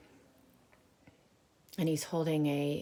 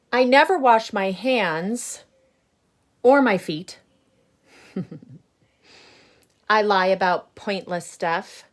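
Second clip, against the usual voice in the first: loud, higher, grumbling seed voice; grumpy bad seed; 4 semitones higher, 12 dB louder than usual. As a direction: boast about being bad, loud. Reading the seed's lines aloud.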